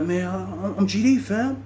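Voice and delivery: high-pitched imitated protest; protesting gang member. Valdosta defending himself to Tony, high-pitched protest. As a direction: plead your case from down the hall. Acted shouted exchange down the dorm.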